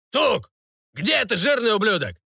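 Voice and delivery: high-pitched yell without much extra volume; sudden baron's yell. A narrator's reading. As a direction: call out for the cook like an irate lord. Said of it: "и неожиданно заорал". (внезапный высокий окрик барона)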